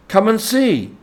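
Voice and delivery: high, loud, bubbling invitation; delighted. A narrator's reading. Rat inviting the mole to see, high and delighted. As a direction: call him over with glee. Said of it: "said the delighted rat".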